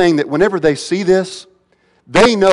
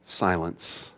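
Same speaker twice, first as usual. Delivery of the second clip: low, hushed, composed order; very calm, quiet command; 9 semitones lower, 13 dB quieter than usual. Acted. Jesus stands up and speaks to the storm, calm quiet command. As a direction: command it softly, with total calm. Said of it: "very calmly and very quietly says".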